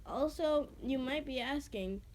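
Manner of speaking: ordinary delivery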